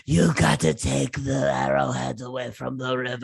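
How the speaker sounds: a hint of a raspy chief giving orders. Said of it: "It was all raspy".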